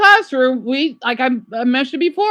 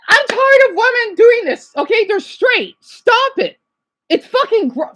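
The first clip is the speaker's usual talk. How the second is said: upset rant; 10 semitones higher than usual. Real, not acted. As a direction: let the disgust burst out. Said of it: "I'm just so upset what they said".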